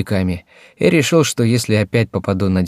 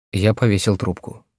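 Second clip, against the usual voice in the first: ordinary delivery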